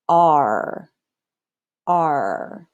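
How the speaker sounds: long stretched R, tongue curled; stretched R sound. Shown as a demonstration. Teacher stretching the R sound slowly for kids. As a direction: draw out R like a pirate growl. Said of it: "slowly stretching it out".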